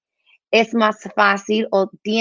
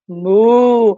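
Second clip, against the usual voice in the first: a loud, round "Mu" like a cow, drawn out; big cartoon cow "Mu"; cow moo; 6 dB louder than usual. Shown as a demonstration. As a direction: moo loudly to show a child the sound. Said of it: "cómo lo hace la vaca".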